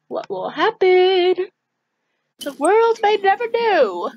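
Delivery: mock mystery narrator. Joking before the drive, a hammed-up dramatic announcer. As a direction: tease the mystery like a cheesy narrator.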